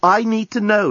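high, insistent demand. A narrator's reading. Lucy presses the journalist to explain, insistent. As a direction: press hard for an answer. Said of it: "insisted Lucy".

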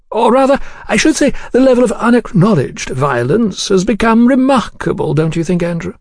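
high, polite voice with veiled threat; suave menacing gentleman. Acted. Gentleman drawing a pistol on the diner, suavely menacing. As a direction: make a threat sound like polite chat, pitched high.

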